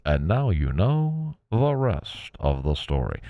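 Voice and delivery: put-on slow, booming old-time radio narrator; Paul Harvey impression. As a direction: drag it out like a 1950s radio host. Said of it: "Thank you, Paul Harvey".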